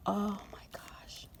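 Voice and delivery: breathless whispered awe; hushed whisper of delight; awed whisper. Real, not acted. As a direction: whisper it to yourself, overwhelmed. Overwhelmed hushed gasp.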